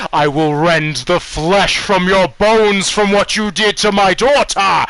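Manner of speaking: loud, high, full-force vengeful shout; enraged roar. Acted. Armored father vows revenge on the party, roaring in fury. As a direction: roar the threat as a villain about to attack.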